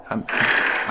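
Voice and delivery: usual speaking voice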